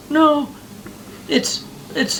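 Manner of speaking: high whiny mock sob; mock crying teacher. Mocking a crying teacher on TikTok, high whiny voice. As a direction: whine it out like a weepy teacher.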